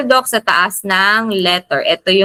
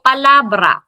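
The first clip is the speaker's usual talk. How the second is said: palabra with LA raised, then falling; stress on second-to-last syllable; 3 semitones higher than usual. Shown as a demonstration. Teacher giving an example word, stress on LA in palabra. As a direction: lift the middle A in "palabra". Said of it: "Pataas sa letter A".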